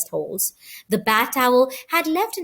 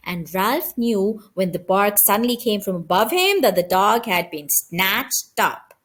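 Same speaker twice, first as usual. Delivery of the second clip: usual speaking voice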